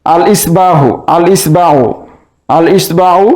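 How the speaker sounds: "আল ইসবাহু" with the article's lam audible; lam pronounced. Shown as a demonstration. Teacher repeating for students, lam sounded in "আল ইসবাহু". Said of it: "উচ্চারণ হচ্ছে".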